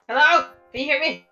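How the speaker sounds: ordinary talk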